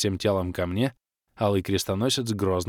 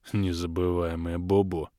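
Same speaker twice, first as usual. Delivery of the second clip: low snorting echo with a grunt; grunting mockery; 3 semitones lower than usual. A narrator's reading. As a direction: grunt it like a pig, mocking. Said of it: "хрюкнул Крей". (с хрюканьем, передразнивая)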